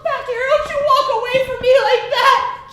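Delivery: angry. Acted. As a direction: bark it after her. Father yelling after daughter.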